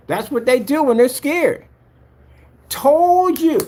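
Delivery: loud, high triumphant crowing; excited victory yelling; gloating. Real, not acted. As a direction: crow over being proven right, loud and high. Gloating over sold-out listing.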